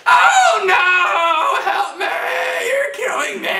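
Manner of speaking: high, loud pleading scream; screaming bow. Pretend. Teacher voicing the overtight bow, shrieking high and loud. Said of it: "This is what I call the screaming bow".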